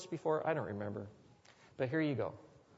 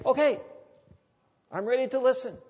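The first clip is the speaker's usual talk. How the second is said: giving in; 9 semitones higher, 4 dB louder, faster than usual. Retold or imitated. Voicing surrender.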